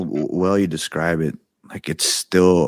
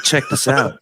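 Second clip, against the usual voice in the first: higher voice for an imitated fairy; playful Tinkerbell being; 7 semitones higher than usual. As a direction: lightly act the being showing off. Retold Tinkerbell showing a trick.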